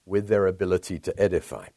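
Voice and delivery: ordinary talk, nothing marked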